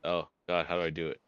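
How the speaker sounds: ordinary talk, nothing marked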